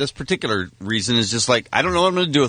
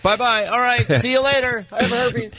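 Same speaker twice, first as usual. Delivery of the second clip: breezy goodbye; 10 semitones higher than usual. Retold or imitated. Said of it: "he's like".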